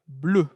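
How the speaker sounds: "Bleu" as one syllable, unchanged; silent final E. Shown as a demonstration. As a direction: model "Bleu" with no extra ending. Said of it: "Es muda, la pronunciación no cambia".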